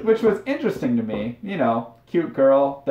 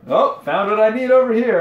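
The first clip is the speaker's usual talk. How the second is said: higher, louder mock-casual excuse; fake excuse; 9 semitones higher, 6 dB louder than usual. [name] dodging the girl down an aisle, mock-casual. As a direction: pretend you just found something, loudly. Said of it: "I was like".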